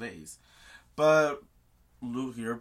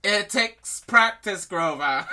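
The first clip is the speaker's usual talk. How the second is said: slow, loud mock holler; comic raised-voice advice; shouted coaching; 7 semitones higher, 9 dB louder, slower than usual. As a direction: draw it out as called across a field. Chiron calling after dragged Grover.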